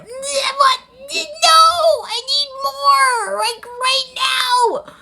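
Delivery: very high, drawn-out fan wail; slow, high, theatrical pleading; desperate whine. Acted. Acts desperation for more episodes.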